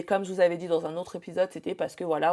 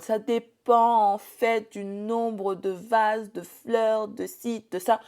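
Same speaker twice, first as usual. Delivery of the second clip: higher, very drawn-out list, imitating a stalling vendor; mock evasive vendor; 4 semitones higher, much slower than usual. Imitating vendors who never give a price, drawn-out mock evasiveness. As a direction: stall and hedge, stretching each item out. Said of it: "Ils sont là en mode".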